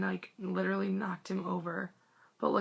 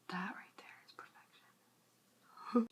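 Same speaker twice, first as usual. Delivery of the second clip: whisper; 9 dB quieter, slower than usual. Real, not acted. Gushing over a photo on camera.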